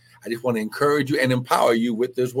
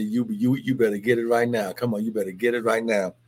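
usual speaking voice

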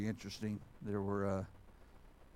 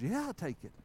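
brightening up, eager; 9 semitones higher than usual. Retold or imitated. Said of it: "His countenance starts changing".

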